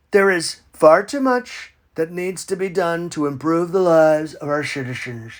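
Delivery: ordinary talk, nothing marked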